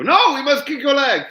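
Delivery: high, loud mock French accent; bad French accent. French fighters insisting to the British, hammed-up French accent. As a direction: shout it in a badly faked French accent. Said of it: "that wasn't a French accent whatsoever".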